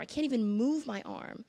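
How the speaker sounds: normal talking voice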